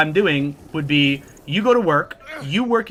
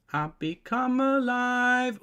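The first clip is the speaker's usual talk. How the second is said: dramatic slow voice; 7 semitones higher, 6 dB quieter, much slower than usual. Pretend. Dramatic mock line, slow.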